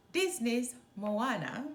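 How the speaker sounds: plain reading voice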